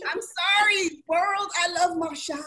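high playful mock apology. Real, not acted. Admitting to listeners she loves Marshalls, playful and high. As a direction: confess it to the world, high and teasing.

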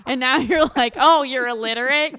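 high, loud incredulous rant; ranting at the heroine in mock outrage. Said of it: "I'm like".

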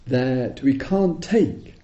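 ordinary talk

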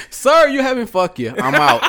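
high, loud, laughing through it; laughing mock exit. Man imagining walking out on a date, laughing. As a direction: toss off the goodbye while cracking up. Acted walkout line, laughing.

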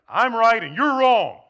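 a self-righteous spouse in a fight; mock argument.

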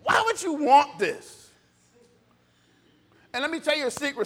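ordinary talk

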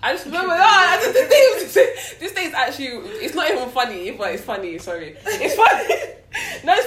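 high, loud helpless laughter through the words; laughing. Real, not acted. Telling friends the story, breaking into laughter. Laughing while retelling assault.

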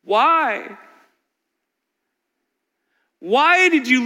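high, loud, tearful pleading to God; anguished crying out. Retold or imitated. Pastor voicing a grieving father's cry to God, anguished and loud. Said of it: "through his bitter tears, he is crying out".

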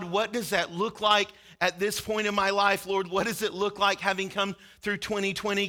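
ordinary delivery

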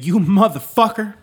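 high, loud pained cry of You motherfucker; mock pained yell; pained howl. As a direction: yelp it out as it hurts. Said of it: "I let out a little howl".

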